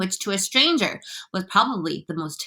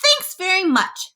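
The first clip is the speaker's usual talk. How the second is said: high, slow sarcastic retort; snapping; 11 semitones higher, slower than usual. A narrator's reading. Fire chief is told to climb first, snapping sarcastically. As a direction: snap it high, stretching the words out. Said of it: "snapped the head of the fire department".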